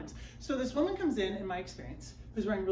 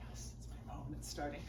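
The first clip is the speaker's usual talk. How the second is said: much softer, lower breathy thrill; whispered excitement before the massage; hushed anticipation; 7 semitones lower, 13 dB quieter, faster than usual. Retold or imitated. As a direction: savor the moment quietly. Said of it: "you're like".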